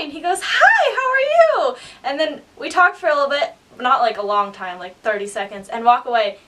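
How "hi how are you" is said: very high, chirpy reply; sing-song mock enthusiasm, high and fairly loud; imitated cheery greeting. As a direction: mimic an over-friendly hello. Actor's friendly reply, retold.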